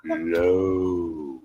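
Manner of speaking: very low, soft, slow croon; mock seductive. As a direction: sink into a hushed bedroom voice, playfully. Continues the mock seduction routine.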